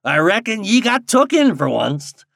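lightly teasing rustic voice, high and loud; a touch of backwoods mockery; chuckling old guide. A narrator's reading. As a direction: rib someone as a country guide would.

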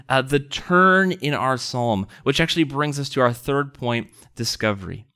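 usual speaking voice

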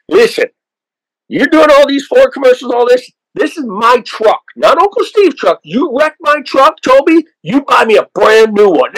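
high, teasing warning to a star; mock warning. [name] lending [name] his truck, teasing him in a high, joking warning. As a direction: wag a finger half in jest, pitched high.

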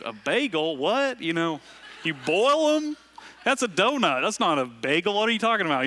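mock outraged New Yorker, pitch leaping up; put-on New York accent. Pastor imagining a comeback to the condescending in-law, in a put-on New York accent. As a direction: pretend brash New York accent, jumps high on What and boil 'em. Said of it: "put on an accent I don't have".

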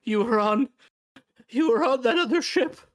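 very high, strained pleading; wretched, aggrieved little man; desperate crawling man. Acted. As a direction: plead in halting bursts, voice pushed way up.

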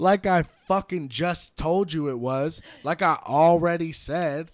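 loud, slow, smug retort; mock know-it-all. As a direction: lay it on like she knows best. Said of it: "You're like".

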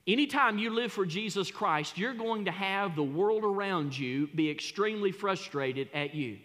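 normal talking voice

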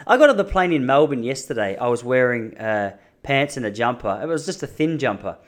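usual speaking voice

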